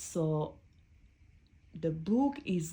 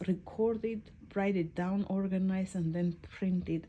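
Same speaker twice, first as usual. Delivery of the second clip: plain reading voice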